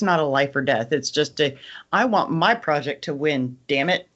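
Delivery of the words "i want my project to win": ordinary talk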